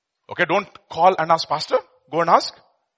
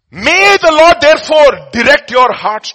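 loud, slow, higher proclamation; proclaiming scripture; 11 semitones higher, 11 dB louder, slower than usual. Real, not acted. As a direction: declare the blessing loudly.

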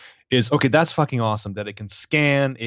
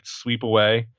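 ordinary talk, nothing marked

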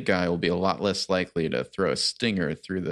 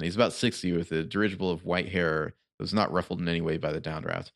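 ordinary talk

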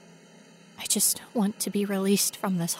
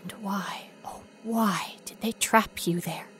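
whisper. Acted. Whispered plea to ghost.

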